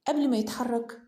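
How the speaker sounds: usual speaking voice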